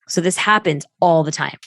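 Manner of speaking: usual speaking voice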